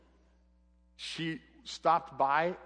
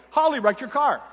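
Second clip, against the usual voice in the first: shouted much higher and louder, almost enjoying it; gleeful yell; 10 semitones higher, 11 dB louder than usual. Retold or imitated. Brother running downstairs announcing the wreck, gleeful shout. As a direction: yell the news with a hint of glee. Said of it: "Almost like he was enjoying it".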